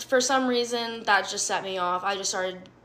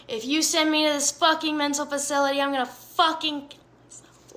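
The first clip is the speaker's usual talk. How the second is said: raised, fast mock tirade; high, rushed imitation of yelling; retold screaming; 6 semitones higher than usual. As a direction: replay a screamed threat at half volume. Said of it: "am screaming in the room".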